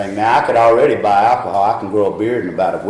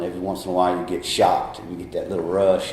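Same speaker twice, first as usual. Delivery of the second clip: ordinary delivery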